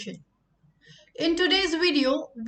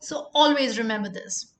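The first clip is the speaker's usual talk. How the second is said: normal talking voice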